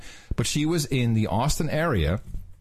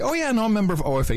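higher and fast, proud member; mimicking the woman; 9 semitones higher, much faster than usual. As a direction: rattle it off eagerly in her higher voice. Mimicking her proud OFA answer.

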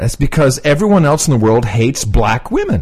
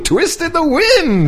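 mocking echo; 13 semitones higher, slower than usual. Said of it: "echoing the phrase in mockery".

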